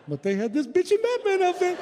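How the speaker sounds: very high, hammed-up squeal; giddy excitement. Husband pitches Batman costume to wife, giddy squeal. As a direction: squeak it out, bursting with mock glee.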